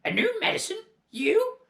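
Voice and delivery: very high, loud hag scoffing; scornful crone yell. Acted. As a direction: sneer it down at him. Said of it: "she yelled".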